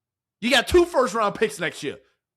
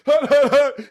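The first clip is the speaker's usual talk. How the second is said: mock quarterback cadence through laughter; a quarterback's hut hut, half laughing; laughing hut call; 5 semitones higher, 7 dB louder, slower than usual. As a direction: bark the snap count while cracking up. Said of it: "His cadence".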